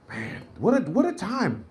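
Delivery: normal talking voice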